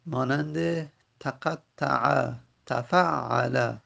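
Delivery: تقطع and تفعل drawn out syllable by syllable; slow Arabic pattern. Shown as a demonstration. Teacher showing students the Arabic pattern, slow and careful. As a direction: spell out تفعل slowly in Arabic. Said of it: "teacher spells out Arabic pattern".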